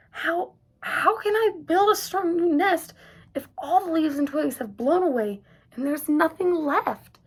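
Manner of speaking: stammering worried question; anxious. A narrator's reading. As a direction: fret over the lost nest. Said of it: "he asked anxiously".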